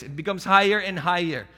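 usual speaking voice

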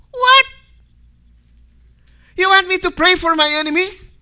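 loud, very high disbelief; acted shock, voice shooting up; incredulous outburst. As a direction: balk at an outrageous request. Acts shocked reply to God's command.

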